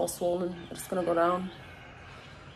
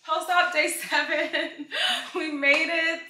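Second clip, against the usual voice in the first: laughing; 10 semitones higher, 3 dB louder, slower than usual. Real, not acted. Relieved laughing milestone.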